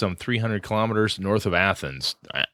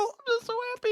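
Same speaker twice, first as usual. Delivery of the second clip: much higher, squeaky overjoyed voice; mock joy; 7 dB quieter, faster than usual. Host gushing over his little Christmas miracle in mock joy. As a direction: gush like a delighted child.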